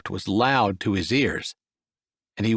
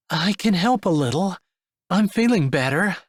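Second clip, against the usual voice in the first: lightly raised female voice; a bit higher, gentle; young woman voice; 6 semitones higher than usual. Acted.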